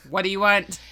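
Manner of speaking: loud, laughing fake-rude demand; mock rude answer. Worker joking about unwelcome callers, loud mock rudeness. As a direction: play at being fed up, very loud. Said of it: "I'm not gonna be like".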